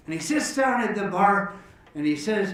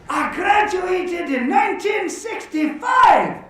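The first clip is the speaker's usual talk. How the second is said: high, loud and drawn-out; hammed-up joke drinker's boast; excited declaration; 10 semitones higher, 5 dB louder, slower than usual. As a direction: announce it to the whole bar. Joke character proudly declares shared year.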